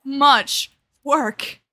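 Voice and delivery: loud, slow exasperated rant; real frustration. Real, not acted. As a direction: vent at the screen, dragging it out. Gamer raging at failed melding.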